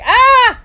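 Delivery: loud, high scream of "Ah!"; imitated scream. Little brother re-enacts his fright, mock scream. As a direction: act out a frightened child's scream. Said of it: "running away from him, Jamie, and screaming".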